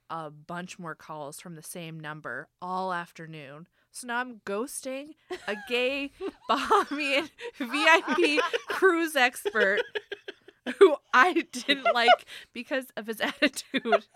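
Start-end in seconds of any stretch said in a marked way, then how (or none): 5.5-14.1 louder, slowing as laughter breaks in; real laughter. Real, not acted. Summing up ghosting the cruise expert, laughing. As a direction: struggle through the line laughing. Cracks up at the story's outcome.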